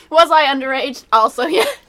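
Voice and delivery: loud, laughing through the words. Real, not acted. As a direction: laugh while confessing it. Laughing confession about drunk tattoo.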